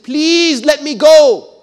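high, loud, slow desperate plea; pleading. Retold or imitated. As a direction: beg him to let you go, drawn out. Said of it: "he pleaded".

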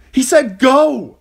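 high, drawn-out forceful shout. A narrator's reading. Arya grabbing Gendry to flee, urgent shout. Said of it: "she shouted".

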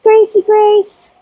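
very high, slow call; cooing call. Real, not acted. Calling goat Gracie, very high and slow. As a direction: sing her name.

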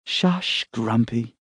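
a hint of a sleepy young woman shushing; young woman's voice. A narrator's reading. Emma voiced, groaned.

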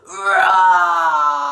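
loud, low mock lion roar. Shown as a demonstration. Teacher roaring like a lion for the class, loud low roar. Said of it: "Can you roar like a lion".

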